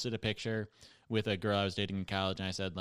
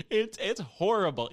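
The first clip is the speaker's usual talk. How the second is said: ordinary talk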